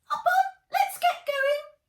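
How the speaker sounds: very high, loud squeaky guinea-lion voice; squeaked. A narrator's reading. Guinea lion asking the robot for the file, high loud squeak. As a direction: squeak it out like a tiny creature. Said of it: "squeaked Scorter".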